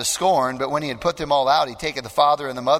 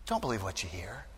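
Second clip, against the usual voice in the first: soft repeat; 8 semitones lower, 13 dB quieter than usual. Real, not acted. Preacher repeats line softly.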